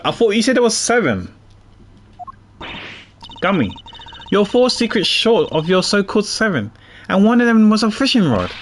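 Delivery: prosecutor character voice. Acted. Reads prosecutor character lines.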